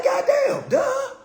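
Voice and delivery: high, disbelieving goddamn; shocked exclamation. Real, not acted. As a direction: squeal it out in disbelief. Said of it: "I'm like".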